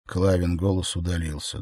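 ordinary delivery, nothing marked

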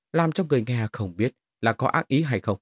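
usual speaking voice

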